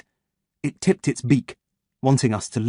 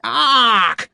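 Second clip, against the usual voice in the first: high loud caw; crow battle cry; 12 semitones higher, 5 dB louder than usual. Acted. Crow leader rallies the flock, shrieking ark. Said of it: "an angry, life-affirming battle cry".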